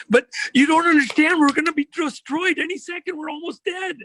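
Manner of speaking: very high, frantic, squeaky terror; a servant certain death is seconds away; hammed-up high-pitched panic; panicked servant. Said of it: "servant panics".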